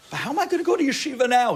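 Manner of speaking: loud, high-pitched quick protest. Retold or imitated. Student making excuses about yeshiva, loud high protest. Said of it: "Listen to the way the Yetzer Hara works".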